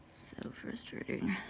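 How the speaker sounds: real exasperated whisper; frustrated whisper. Real, not acted. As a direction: whisper it to yourself, defeated. Frustrated at own work.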